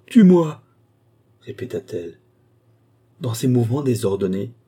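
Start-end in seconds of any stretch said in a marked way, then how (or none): 0.1-0.6 "tue-moi" screamed out much higher and louder; woman screaming at the marquis to kill her; desperate scream. Acted. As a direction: hurl the plea at him at full force. Desperate plea to be killed.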